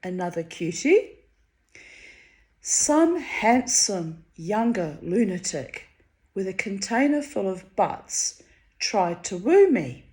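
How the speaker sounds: plain reading voice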